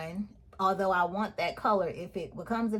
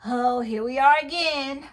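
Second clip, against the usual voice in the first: high, loud "here we go" complaint; acted exasperation; 5 semitones higher, 6 dB louder than usual. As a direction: replay your own exasperated outburst, pitched up and louder. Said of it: "So I was just like".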